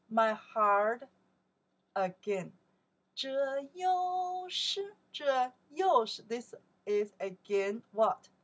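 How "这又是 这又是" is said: stretched-out 这又是, higher; very slow. Shown as a demonstration. Teacher slows down so students can follow. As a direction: draw out 这又是 slowly for learners. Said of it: "follow me, super slow".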